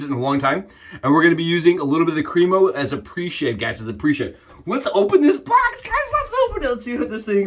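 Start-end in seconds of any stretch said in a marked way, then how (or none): 4.7-7.5 excited. Real, not acted. Eager to unbox product.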